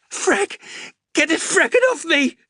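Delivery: very high, loud panicked screams; panicked shrieking. Acted. Wounded Xander begs them to free his arm, shrieking. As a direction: scream to get the cord off. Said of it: "shrieked Xander".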